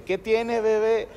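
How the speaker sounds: a hint of cooing at a child; imitating Alfredo. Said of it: "imitar las voces de Alfredo y Adriana". (imitación ligera de voz melosa)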